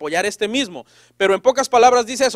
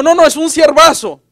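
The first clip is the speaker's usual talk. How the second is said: loud, high, mock boasting parent; defensive parent; 6 semitones higher, 7 dB louder than usual. Parent asked about the rebellious son, in loud insistent denial.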